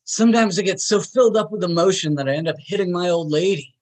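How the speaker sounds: imitated manic rant, words racing; coked-up babbling. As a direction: gush it out like someone wired. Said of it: "he's in a coked up rant".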